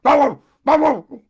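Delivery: loud, high dog barks; barking like a watchdog. Acted. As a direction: bark it out loud like a guard dog. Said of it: "he barked exactly like a watchdog".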